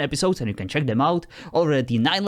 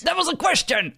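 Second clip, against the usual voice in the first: much higher, mock-outraged gotcha; exasperated exclamation. Real, not acted. User catches the AI breaking his rule, high mock outrage. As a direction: call it out like catching a cheater.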